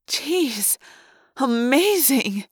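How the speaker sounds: high, drawn-out gasp of wonder; awed amazement. A narrator's reading. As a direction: marvel at it, stretching the word out. Girl marvels at the nebula.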